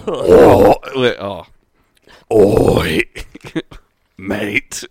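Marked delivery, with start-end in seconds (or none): 2.3-4.8 loud British-accented grunt; British demon voice. Shown as a demonstration. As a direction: throw it out like a gruff Brit. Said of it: "speak in a British accent".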